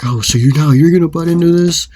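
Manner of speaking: retold hostile question; angry challenge. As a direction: act out turning on an intruder. Acted challenge to interfering friend.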